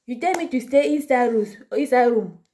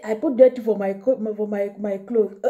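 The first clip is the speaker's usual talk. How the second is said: ordinary delivery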